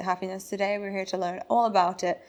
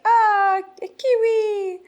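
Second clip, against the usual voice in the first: much higher, louder, bright "a Kiwi"; delighted Europeans; 12 semitones higher, 8 dB louder, much slower than usual. Retold or imitated. Europeans greeting the New Zealander, high excited exclamation. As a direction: gush like a thrilled stranger. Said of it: "they're like".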